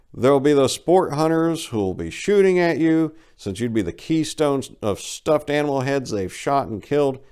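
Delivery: ordinary delivery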